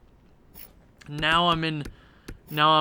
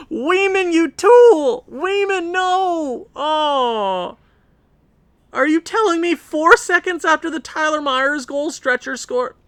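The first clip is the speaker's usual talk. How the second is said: much higher, louder angry shout; furious yelling; 11 semitones higher, 8 dB louder, slower than usual. Real, not acted. Gamer yelling at his goalie for the goal. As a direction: scream at the goalie.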